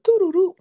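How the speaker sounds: high sing-song alarm chime; imitated door chime. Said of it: "like someone was coming in the door".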